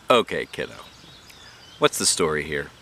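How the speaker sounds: teacher voice. A narrator's reading. Teacher asking Junie B in the hall, gentle. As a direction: ask it kindly, a grown-up bending down. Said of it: "she asked".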